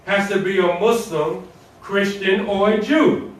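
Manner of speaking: usual speaking voice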